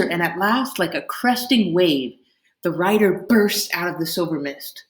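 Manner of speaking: plain reading voice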